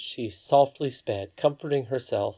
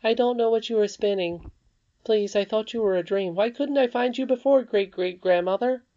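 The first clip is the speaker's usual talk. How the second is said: put-on high child voice, earnest and curious; a little princess pleading to know; young Irene; 9 semitones higher than usual. A narrator's reading. Irene asking her grandmother, high earnest child voice.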